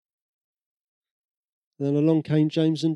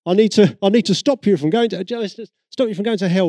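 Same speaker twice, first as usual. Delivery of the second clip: loud, high mock hellfire street preacher; mock pushy preacher; 4 semitones higher, 7 dB louder than usual. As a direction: ham up a pushy preacher shouting at a stranger. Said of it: "I wasn't like".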